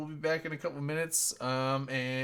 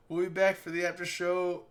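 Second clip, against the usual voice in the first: usual speaking voice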